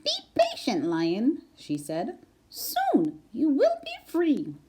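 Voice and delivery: calm the trapped lion gently; little mouse. A narrator's reading.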